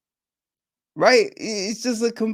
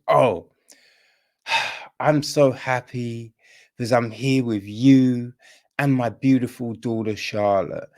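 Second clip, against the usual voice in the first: stiff film line; 7 semitones lower than usual. Retold or imitated. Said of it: "you're not saying it like that".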